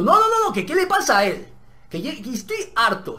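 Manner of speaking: high, loud fed-up protest that fades; exasperated shout. Retold or imitated. Student protests loudly to the teacher, exasperated. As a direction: blurt it out, sick of it.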